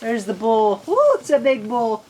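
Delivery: ordinary delivery, nothing marked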